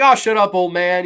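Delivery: loud, high mock brush-off; dismissive snap. Couple dismiss the grave digger's warning, loud scornful retort. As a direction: shout him down with scorn.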